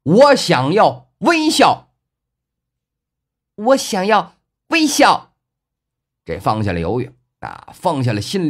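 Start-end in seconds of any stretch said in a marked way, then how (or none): 0.0-5.3 slow, high, drawn-out shout; shouting together. A narrator's reading. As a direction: lead a long, loud holler. Two characters shouting the wish together.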